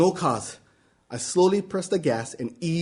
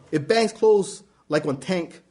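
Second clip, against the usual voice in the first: ordinary delivery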